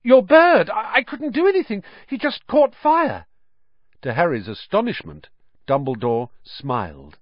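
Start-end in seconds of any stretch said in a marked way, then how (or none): 0.0-3.2 high, loud, frantic schoolboy; alarmed, desperate to explain; panicked gasping. A narrator's reading. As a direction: blurt it out in shock, pitch way up. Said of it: "Harry gasped".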